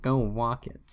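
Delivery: "walk" said with a w sound instead of r, for "work"; childlike "walk" with w for the r in "work"; says w for r. Retold or imitated. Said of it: "I can't pronounce my R's".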